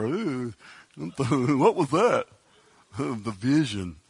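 slow, dazed and chuckling; dazed Peter, laughing. Retold or imitated. Preacher voicing Peter dazed after the vision, laughing. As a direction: play the dazed fisherman through a laugh.

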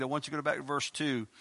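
ordinary talk, nothing marked

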